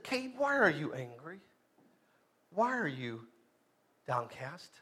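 low, hushed, halting question; quiet questioning. Acted. God questioning downcast Cain, quiet low questioning. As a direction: ask it softly, with pauses.

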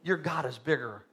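hushed pastoral aside; quiet, intimate preaching. Real, not acted. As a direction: drop way down, soft and low. Quiet reflective stretch of preaching.

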